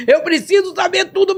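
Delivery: high, insistent Eu preciso saber tudo without laughing; urgent plea. Retold or imitated. Begging Jesus to tell her everything, high and urgent. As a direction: press the demand up high.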